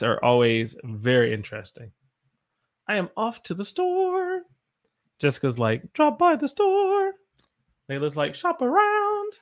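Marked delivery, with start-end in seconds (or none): 2.9-4.4 much higher sing-song line; going up in octaves. Pretend. Teacher reading chat lines climbing in pitch. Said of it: "going up in octaves". 5.9-7.1 even higher, slow and stretched; going up in octaves. Pretend. Teacher reading chat lines climbing in pitch. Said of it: "going up in octaves". 8.3-9.3 highest squeaky note; going up in octaves. Pretend. Teacher reading chat lines climbing in pitch. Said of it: "going up in octaves".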